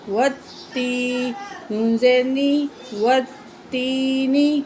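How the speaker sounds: every sound stretched very slowly; slow blended reading. Shown as a demonstration. Teacher joining syllables into a word for the pupil, very slow. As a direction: draw the joined word out carefully.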